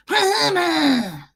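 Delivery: high, loud, drawn-out wail; mock scary call. Imagining scaring strangers, high loud mock-eerie wail. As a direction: wail it out high to startle someone. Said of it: "How funny would it be to be like".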